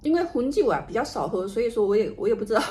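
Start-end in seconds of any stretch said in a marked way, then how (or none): none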